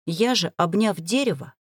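plain reading voice

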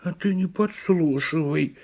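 a touch of offence; offended turtle. A narrator's reading. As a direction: object huffily as a little turtle. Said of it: "обиделась «Именно я»".

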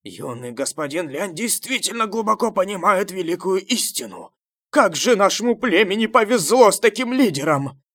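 high, loud cheering, building in force; shouted approval. A narrator's reading. Fanatic followers praising their leader, shouting approval. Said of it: "выкрикивать слова одобрения".

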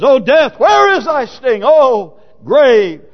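high, loud rhetorical challenge; declaimed scripture. Acted. Preacher quoting the challenge to death, ringing declaiming. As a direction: fling the question out from the pulpit.

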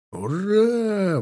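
high, drawn-out hurrah; high-pitched sustained war cry; battle cry. A narrator's reading. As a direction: cheer a cavalry charge. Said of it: "грянуло клич множества глоток".

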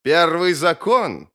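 drawn-out scornful question; sneering echo. Acted. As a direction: throw the words back with a sneer. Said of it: "Маг оскалил зубы в усмешке".

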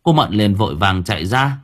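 plain reading voice

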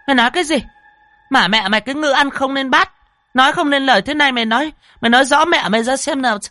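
very high, loud furious shriek; shrieking mistress. A narrator's reading. Mistress shrieks at the stammering servant, furious. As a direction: hysterical wife berating servant.

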